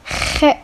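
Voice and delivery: lone "خ" breathed rough from the throat, no voice; teacher isolating the "خ" sound; isolated kh sound. Shown as a demonstration. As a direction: hiss out a bare "خ" from the back of the throat. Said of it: "it's pronounced خ".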